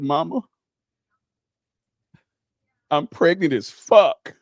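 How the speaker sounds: put-on blunt, slow teen announcement with a long pause; dead-serious confession. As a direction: drop the news slowly, dead in the eye. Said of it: "looked at you dead in your eyes".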